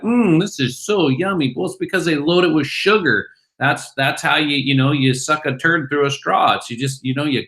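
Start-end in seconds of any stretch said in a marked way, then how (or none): 0.0-1.5 higher mock delight, savouring it; mock yummy. Guest mocking Americans who swallow garbage, mock delight. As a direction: play a gullible consumer happily slurping. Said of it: "and we're like".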